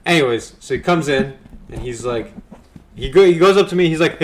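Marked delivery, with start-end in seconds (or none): none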